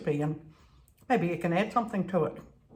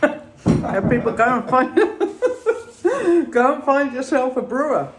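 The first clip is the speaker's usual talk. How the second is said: laughing; 5 semitones higher, 9 dB louder than usual. Real, not acted. Laughing through a closing tip.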